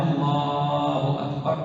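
slow "الله" held on its second vowel, short "أكبر"; long vowel in "الله". Shown as a demonstration. Teacher modelling the correct takbir, madd on "الله". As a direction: stretch the "laa" of "الله" only. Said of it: "في لفظ الجلالة".

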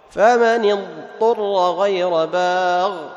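loud, slow chanted verse with ض held in اضطر; clear Dad recitation. Shown as a demonstration. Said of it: "تظهر الضاد ثم تأتي بحرف الطاء بعدها".

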